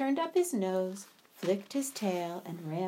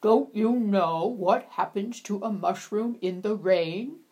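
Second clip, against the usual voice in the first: louder, knowing frog voice; teasing frog; 6 dB louder than usual. Acted. Frog mocks the animals before hopping away, louder and knowing. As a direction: tease them with the secret.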